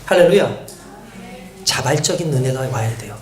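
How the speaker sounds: ordinary talk, nothing marked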